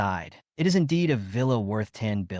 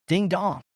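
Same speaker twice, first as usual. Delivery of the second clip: high bright ding dong; spoken chime; 7 semitones higher than usual. A narrator's reading. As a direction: chime it like a phone alert. Phone notification chime.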